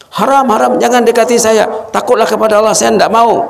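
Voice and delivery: high, insistent refusal; warding her off in alarm; father refusing. Acted. The father warding off the stranger he thinks she is, high and insistent. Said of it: "Kata si, si ayah".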